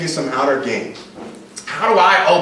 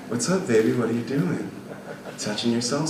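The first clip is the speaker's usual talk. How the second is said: lower, softer bedroom murmur; intimate seductive voice; 5 semitones lower, 6 dB quieter than usual. Shown as a demonstration. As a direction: ease into it gently, low and intimate. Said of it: "talk to women like they're masturbating".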